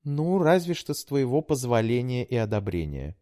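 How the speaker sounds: put-on high, pleading young woman; daughter voice. A narrator's reading. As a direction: coax like a sweet daughter. Daughter voice held.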